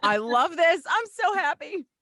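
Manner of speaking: high, loud gushing joy; excited. Real, not acted. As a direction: gush happily. Guest thrilled on air.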